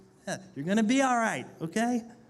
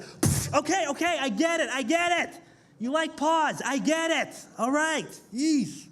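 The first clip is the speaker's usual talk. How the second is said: higher, exasperated brush-off; fed up; 6 semitones higher than usual. Retold or imitated. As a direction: wave it off, sick of it.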